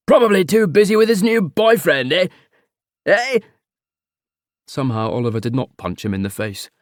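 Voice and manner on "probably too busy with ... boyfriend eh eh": much higher and louder, blokey ribbing; an uncle guffawing at his own joke; jeering uncle. Acted. Uncle teasing his nephew, loud and high. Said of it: "unleashed an infuriating chortle".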